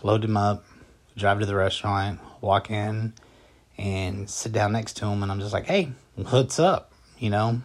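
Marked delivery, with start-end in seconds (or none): 5.7-6.9 drawn-out, higher-pitched breezy hello; mock-casual greeting. Husband greets wife's date at the restaurant, mock-casual. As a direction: toss out a slow, casual greeting.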